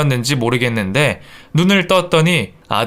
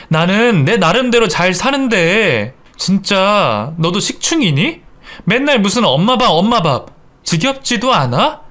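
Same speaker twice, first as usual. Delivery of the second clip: higher, needling complaint; irritated retort; 5 semitones higher than usual. Acted. Wife mocking husband about mom's food, irritated. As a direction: throw it back at him, annoyed.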